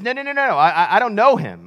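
high rattled-off string of protests, acted out; mock panicky denial, starting high and tumbling down; flustered denial. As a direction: imitate someone hastily disowning a friend. Peter flustered, denying Jesus.

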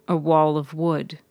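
ordinary delivery

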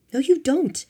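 loud, high, flat-out refusal; shouted contradiction. A narrator's reading. Marianne shouting down her boyfriend's plan to leave. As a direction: cut him off with a shout. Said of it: "Marianne shouted".

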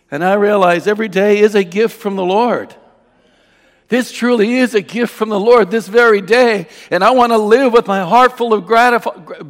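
loud, high, ringing exhortation; full-force preaching. Real, not acted. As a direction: proclaim it to the congregation with fervour.

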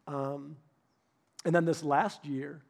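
usual speaking voice

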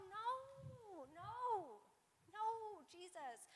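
tiny, high, very soft "no"; faint high whimper of protest, drawn out; mock reluctant refusal. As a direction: plead softly in a small high voice. Mimics selfish people refusing to help.

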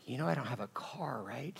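faint breathy whisper with a chuckle; dying man's whisper. Retold or imitated. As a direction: whisper it weakly from a hospice bed. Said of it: "kind of chuckled, and he goes".

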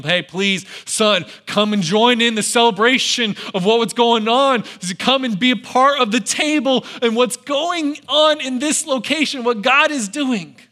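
pleading father. Acted. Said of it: "The father's begging him".

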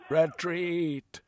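high, loud cry to fall back; shouted order. A narrator's reading. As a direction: yell the retreat across the battlefield. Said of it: "shouted the Pearly King".

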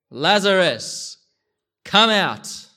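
calling out high and loud, drawn out. A narrator's reading. Jesus calls Lazarus out of the tomb, loud slow call. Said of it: "Jesus called in a loud voice".

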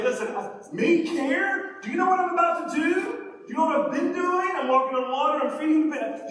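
high, loud self-important protest; mock indignant. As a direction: play someone defending himself, hammed-up. Acts the indignant self-defence Jesus avoided.

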